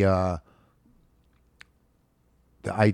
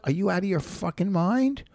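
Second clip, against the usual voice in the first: retold outrage; 10 semitones higher than usual. Retelling confronting the drunk actress.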